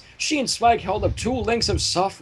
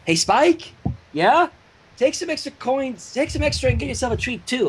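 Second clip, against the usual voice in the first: higher lightly voiced Twilight calling out; voicing Twilight; 7 semitones higher than usual. A narrator's reading. Twilight calls to Spike in the kitchen, lightly voiced. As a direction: call out to Spike, friendly.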